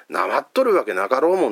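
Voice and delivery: indignant Hakata dialect. Retold or imitated. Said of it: "お前なまってるな".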